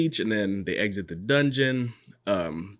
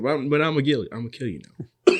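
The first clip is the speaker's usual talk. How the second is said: mock threat; 6 semitones higher, 7 dB louder, faster than usual. Old mage threatening the assassin.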